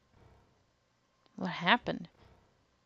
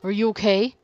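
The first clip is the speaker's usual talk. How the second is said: worried call; 9 dB louder than usual. Acted. Junpei rushes to fallen June.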